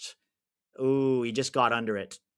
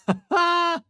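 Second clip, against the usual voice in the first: laugh; 3 dB louder than usual. Real, not acted. Reacting to a chat message.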